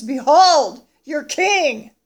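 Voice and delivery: booming, drawn-out announcement; loud proclamation. Acted. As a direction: proclaim it to a whole crowd. Pilate presents the king to crowd.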